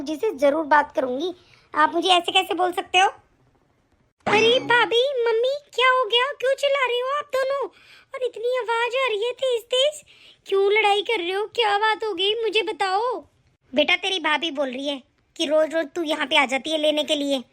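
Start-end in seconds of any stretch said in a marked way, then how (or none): 4.2-13.1 put-on higher young woman's voice, alarmed; the daughter rushes in to stop the fight; sister-in-law asks why they are shouting, in a higher voice; daughter's voice.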